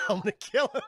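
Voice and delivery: laughing vow. Retold or imitated. Samuel Jackson vowing revenge, laughing.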